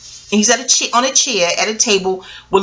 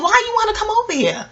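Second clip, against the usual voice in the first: high, sharp mock challenge; retold friend pushing back at the man; challenging friend; 12 semitones higher, faster than usual. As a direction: throw the question back at him, pitched up. Friend confronts man in retold scene.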